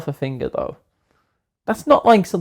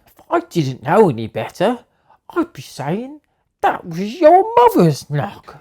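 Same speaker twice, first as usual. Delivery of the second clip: put-on high, drawn-out comic European accent; some sort of European accent; 6 semitones higher than usual. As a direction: ham up a vague European accent, climbing higher. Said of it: "That is some sort of European accent".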